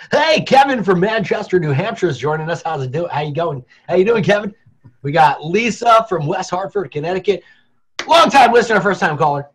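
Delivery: high, loud hammed-up radio DJ; radio host. Host greeting listeners as they join, mock radio voice. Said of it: "don't make me do my radio".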